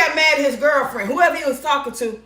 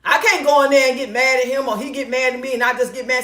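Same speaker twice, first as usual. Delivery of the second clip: ordinary talk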